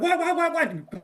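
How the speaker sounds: high-pitched repeated questioning; imitated alarmed girlfriend. Girlfriend answering his screams from across the house, imitated alarm. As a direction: mimic a startled reply to screaming.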